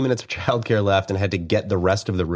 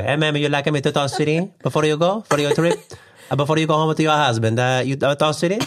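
put-on high-pitched Italian accent, questioning lilt; Italian stock boy accent; 7 semitones higher, faster than usual. Stock boy propositioning a ma'am before her trip, high Italian accent. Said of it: "Now that I'm just an Italian stock boy".

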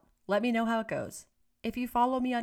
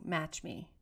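soft subtle cue; 3 semitones lower, 7 dB quieter than usual. Shown as a demonstration. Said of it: "Or I'm shrugging my shoulders and saying".

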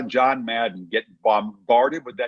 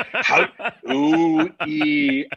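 choppy syllable-by-syllable imitation; staccato; 3 semitones higher, much slower than usual. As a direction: break the name into slow, separate syllables. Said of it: "like, syllable by syllable".

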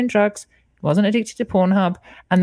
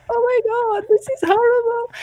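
high, whimpering mock sob voice; hammed-up high crying whine; whiny crying. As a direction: wail it like a self-pitying cry. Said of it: "I just cried like a bitch".

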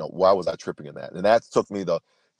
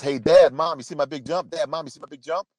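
put-on excited little boy; 8 semitones higher than usual. Young son calling to dad and mom after his jump, eager child voice. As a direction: play the son shouting proudly from the playground. Son calls out from swing set.

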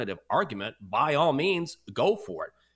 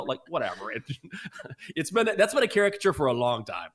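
ordinary talk, nothing marked

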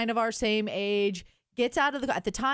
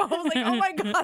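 ordinary talk, nothing marked

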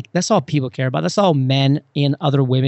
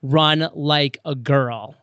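slow, deliberate commercial tagline; much slower than usual. Retold or imitated. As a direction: draw it out like an ad slogan. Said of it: "the Nike's like".

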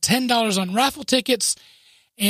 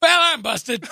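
laughing confession; 7 semitones higher, 4 dB louder than usual. Retold or imitated. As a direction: caught out, laughing as you admit it. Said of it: "I said".

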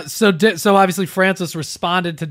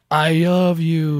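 drawn-out imitation of a fighter's accent; lightly imitated accent, very slow; Yoel Romero; 2 semitones higher, 3 dB louder, much slower than usual. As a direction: slowly mimic the fighter's accented catchphrase. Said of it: "mimicking Yoel Romero".

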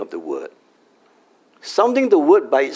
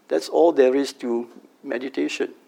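normal talking voice

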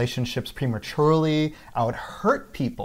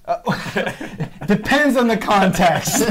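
high, slow words breaking into laughter; stall, then laugh the answer out loudly; laughing dodge; 10 semitones higher, 5 dB louder than usual. Real, not acted. Dodging why his intuition fails in relationships, laughing.

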